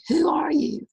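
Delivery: put-on distant echo of the boy's call. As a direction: let it come back fainter. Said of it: "And he heard".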